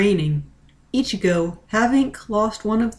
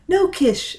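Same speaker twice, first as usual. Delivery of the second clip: higher, firm no; sharp refusal; 7 semitones higher than usual. A narrator's reading. Ichigo refusing Kish sharply. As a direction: cut him off sharply. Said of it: "she said sharply".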